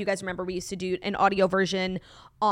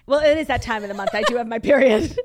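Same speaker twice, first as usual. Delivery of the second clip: laughing, louder; 3 semitones higher, 7 dB louder than usual. Real, not acted. Laughing while telling listeners about her period. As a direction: giggle through the words.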